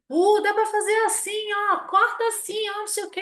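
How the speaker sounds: mock yell. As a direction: blurt it out like an overeager new hire. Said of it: "gritei assim".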